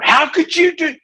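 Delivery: hammed-up shout at the teen; imitating a parent losing it; mock upset yell. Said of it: "Instead of".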